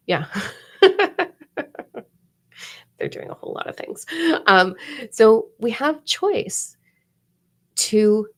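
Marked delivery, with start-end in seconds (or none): 3.0-4.0 fast hushed aside; whisper. Real, not acted. Aside about July's energy.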